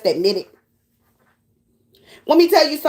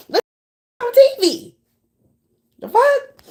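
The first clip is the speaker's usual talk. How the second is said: shocked outburst; 10 semitones higher than usual. Real, not acted. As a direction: blurt it out in disbelief.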